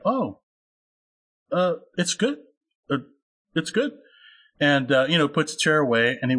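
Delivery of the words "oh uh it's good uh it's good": imitated reticent dad, higher and halting; hesitate, then offer mild, tentative praise; guarded, hesitant approval. Imitates guarded dad's halting verdict.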